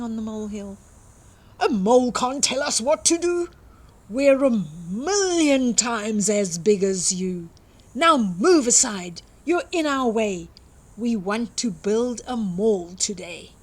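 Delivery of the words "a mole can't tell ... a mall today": louder, fast booming truck voice; big diggers bullying the mole; bossy trucks. Acted. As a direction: push the little mole aside. Trucks boss the mole around.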